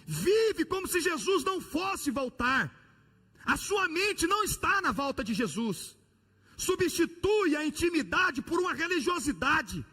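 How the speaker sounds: high, loud, impassioned sermon; fiery preaching. Acted. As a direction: preach it with rising fire. Preaching at full force.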